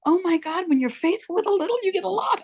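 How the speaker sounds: high, thrilled exclamation of joy; overjoyed gasp. Retold or imitated. Author hugging her first book, high overjoyed cry. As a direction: gush with delight over the new book.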